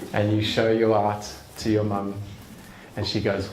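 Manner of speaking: ordinary talk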